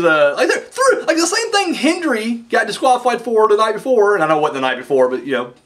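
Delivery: ordinary talk, nothing marked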